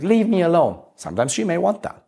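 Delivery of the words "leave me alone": mild mock outburst. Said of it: "she's like".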